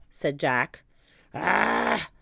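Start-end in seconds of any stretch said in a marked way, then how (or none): 1.3-2.1 loud growled pirate captain. A narrator's reading. Cap'n Bones setting the dogs to work, growling. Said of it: "growled Cap'n Bones".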